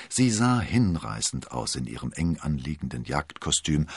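ordinary delivery, nothing marked

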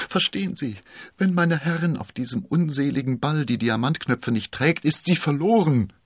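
desperate plea. A narrator's reading. Constanze fleht D'Artagnan an, verzweifelt. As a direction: beg him to understand the danger.

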